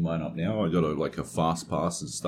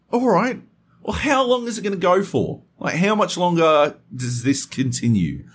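much higher, louder, strained asking; exasperated demand; 12 semitones higher, 5 dB louder than usual. Retold or imitated. Pressing the neighbour on how long it lasts, high and strained. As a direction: push for an answer in disbelief. Confronting noisy upstairs neighbour.

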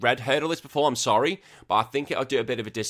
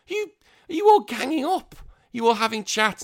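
high, loud outraged cry at the listeners; exasperated shout; 14 semitones higher, 6 dB louder than usual. Real, not acted. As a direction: throw it out high and loud, mock betrayed. Mock outrage at plotting listeners.

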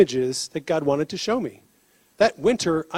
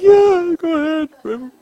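high, loud mock yes from a crying woman; tearful woman's yes; 11 semitones higher, 5 dB louder, slower than usual. Crying woman says yes to the stranger's prayer, high and loud. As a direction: blurt it out high through tears. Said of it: "She's like".

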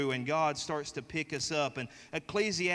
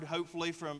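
ordinary delivery

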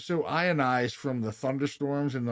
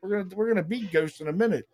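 higher, half-laughing mock terror; laughing mock panic; 7 semitones higher than usual. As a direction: laugh through retelling the fright. Retold panic, laughing through it.

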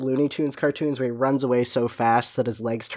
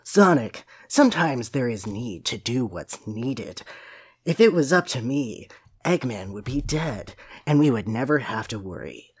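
Shadow, brooding; 5 semitones lower, 5 dB quieter than usual. Acted. Co-host reads Shadow's line about crying Tails, low and brooding. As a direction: mutter it low and gruffly dismissive.

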